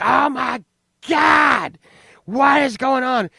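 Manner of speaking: a mom shrieking in alarm on waking; high mock shout of shock.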